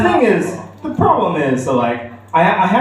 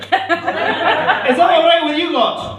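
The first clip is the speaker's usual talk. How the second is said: Scottish accent; 13 semitones higher, much slower than usual. Shown as a demonstration. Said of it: "I think I'm not too bad at a Scottish accent".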